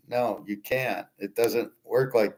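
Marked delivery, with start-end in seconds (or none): none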